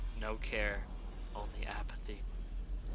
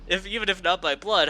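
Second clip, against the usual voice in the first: ordinary delivery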